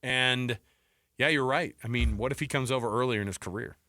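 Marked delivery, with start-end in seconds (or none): none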